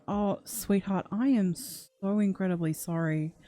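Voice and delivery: slow, heartfelt consoling; tender condolence. Real, not acted. Streamer consoles a bereaved viewer, tender condolence. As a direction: linger gently on each word to comfort.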